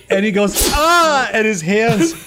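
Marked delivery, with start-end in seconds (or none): 0.7-1.3 high mock cry of pain; acted high "ah" of pain. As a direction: yelp as if suddenly hurt. Rory cries out as blades pop.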